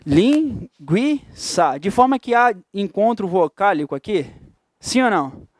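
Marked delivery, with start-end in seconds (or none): 0.1-1.8 "Linguiça" stretched very slowly, syllable by syllable; syllables drawn out. Shown as a demonstration. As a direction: spell out "Linguiça" slowly for the class. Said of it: "Separa a sílaba de linguiça". ("Linguiça" bem devagar, sílaba por sílaba)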